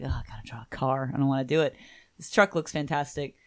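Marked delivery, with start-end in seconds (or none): 0.0-1.8 whining whisper. Retold or imitated. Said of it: "artists who are like".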